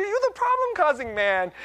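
high, loud mock-scolding wife; imitating scolding wife. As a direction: scold playfully way up in pitch. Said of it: "she says it with this sweetness underneath".

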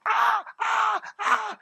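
put-on high, loud squeals like excited kids; imitated kids squealing. Kids greeting their dad with high squeals.

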